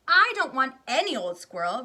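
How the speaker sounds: high, very loud tantrum; bratty shout. Acted. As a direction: yell it at mummy. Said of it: "Veruca shouted".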